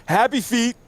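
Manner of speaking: high, loud meme shout. Retold or imitated. Recalling a meme to friends, high shouting. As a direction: belt it like a viral clip. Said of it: "remember the".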